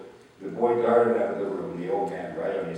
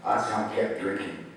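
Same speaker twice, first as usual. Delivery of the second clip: plain reading voice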